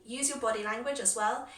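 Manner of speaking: normal talking voice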